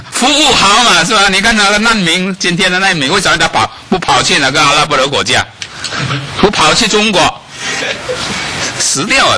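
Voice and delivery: high, loud sermon rhetoric; fervent preaching. Acted. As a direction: drive the point home from the pulpit. Mocking why refugees come.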